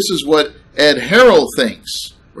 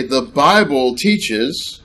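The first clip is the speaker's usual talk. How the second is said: The Bible teaches spelled out slowly, word by word; slow, pointed quotation; slow, stressed quote; much slower than usual. Shown as a demonstration. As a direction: linger on "The Bible teaches" to mark the missing phrase. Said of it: "notice what it does not say".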